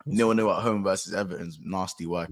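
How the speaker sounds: normal talking voice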